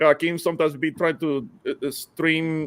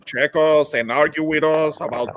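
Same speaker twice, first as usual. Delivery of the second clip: normal talking voice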